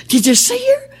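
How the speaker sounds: a little sister bursting to know; excited question. Acted. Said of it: "she asked excitedly".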